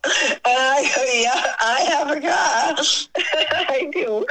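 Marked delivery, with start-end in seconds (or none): 1.1-4.2 high, tickled reply with laughter; amused, laughing quote; laughing retold answer. Retelling an exchange while laughing.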